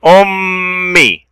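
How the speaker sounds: "أمي" very slow, m and final ee held; long sound. Shown as a demonstration. Teacher showing the long sound of mim, drawn-out "أمي". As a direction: stretch "أمي" into a hummed m and long ee. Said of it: "صوت طويل للميم".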